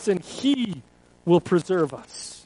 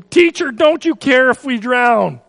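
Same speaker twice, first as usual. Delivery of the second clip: loud, high desperate plea to the teacher; frightened disciples shouting over the wind; panicked cry; 4 semitones higher, 6 dB louder than usual. Acted. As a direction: cry out in terror over the storm. Disciples crying out in storm.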